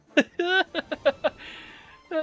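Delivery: loud, high burst of laughter; amused laugh. Real, not acted. Player cracking up at Sylvando's boat, amused laugh.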